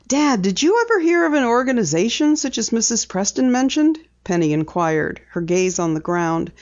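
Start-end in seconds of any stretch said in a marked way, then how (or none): 0.1-4.0 lightly voiced higher daughter, inquiring; daughter, curious. A narrator's reading. Daughter quizzes her father about the organization, curious.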